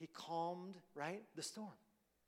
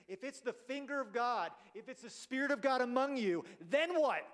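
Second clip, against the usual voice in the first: rising higher and louder to a ringing "then what"; building challenge; 6 semitones higher, 6 dB louder than usual. Real, not acted. Preacher challenging his congregation, building to a loud question. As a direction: press the question home, climbing.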